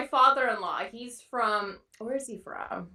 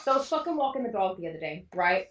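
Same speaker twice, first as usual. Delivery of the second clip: ordinary talk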